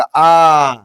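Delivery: आ held long and loud; long vowel. Shown as a demonstration. Teacher showing a long vowel takes time, drawn-out आ. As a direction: stretch आ out with force. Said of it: "इसमें ज्यादा समय लगेगा".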